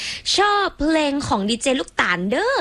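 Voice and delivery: Isan dialect reading. Retold or imitated. DJ reads a fan's comment in Isan tones. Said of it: "ต้องอ่านให้ถูกนะคะ มีวรรณยุกต์มา".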